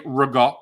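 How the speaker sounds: rigot as "ruh-GAHT", last vowel dropped; New York pronunciation. Shown as a demonstration. Co-host demonstrating New York ricotta, said "ruh-GAHT". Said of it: "from New York".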